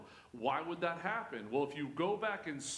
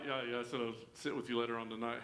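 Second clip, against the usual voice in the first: ordinary delivery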